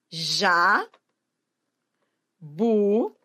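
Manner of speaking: "Já", pause, "bu", each held alone; syllables separated. Shown as a demonstration. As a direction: count out "Já, bu" as isolated syllables. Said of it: "separar a sílaba".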